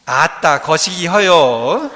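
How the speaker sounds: loud, drawn-out Jeolla drawl; Jeolla dialect. Shown as a demonstration. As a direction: put on a hammy Jeolla accent, slowly. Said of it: "전라도 사투리로 뭐라고 할까요?". (전라도 사투리로 구성지게)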